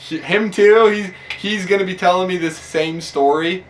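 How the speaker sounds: can't believe he's hearing it again; exasperated disbelief. Retold or imitated. Sheriff to Travis, hearing the same craft story, incredulous.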